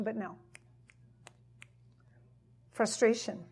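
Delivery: ordinary delivery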